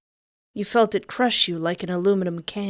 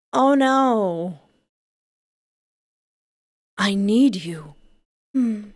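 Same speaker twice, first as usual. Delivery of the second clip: ordinary delivery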